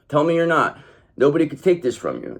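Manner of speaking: ordinary delivery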